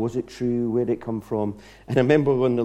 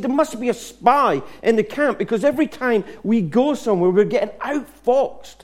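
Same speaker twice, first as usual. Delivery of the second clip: frustrated king; 9 semitones higher than usual. Retold or imitated. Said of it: "he was getting so annoyed, so frustrated".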